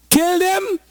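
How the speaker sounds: shrill high-pitched cry of kill; high shout. Acted. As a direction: shout it high as a soldier demanding death. Preacher voicing soldiers shouting.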